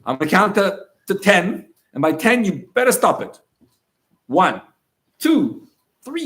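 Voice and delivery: put-on loud strict dad warning a screaming toddler; stern countdown. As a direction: count the kid down like you mean it.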